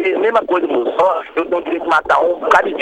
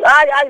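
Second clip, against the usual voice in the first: mock "ai, ai" cry, higher and louder; imitating pained cries; 7 semitones higher, 7 dB louder than usual. Shooter retelling the victim's cries to the host, mock yells. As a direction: mimic the shot man yelling in pain. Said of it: "me gritando".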